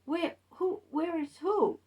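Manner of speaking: high, puzzled stammer; confused boyfriend. Retold or imitated. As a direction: play the baffled man caught off guard.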